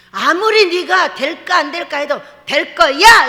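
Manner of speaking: loud, bold declaration; confident shout. Acted. Preacher modelling a faithful declaration, loud and sure. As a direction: shout it like you already believe it. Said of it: "이렇게 말을 하는 거예요".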